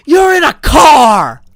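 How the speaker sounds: drawn-out, exasperated mock shout back at coach; mad runner's shout. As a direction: imitate an outraged yell, very loud and high. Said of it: "the guy's just so mad at him".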